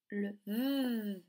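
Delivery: "v" held as a long buzz; isolated sound. Shown as a demonstration. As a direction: draw out the sound "v" without a vowel. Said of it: "quelle syllabe on entend".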